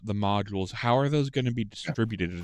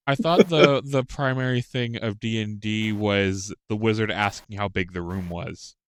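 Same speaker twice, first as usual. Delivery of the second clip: ordinary talk, nothing marked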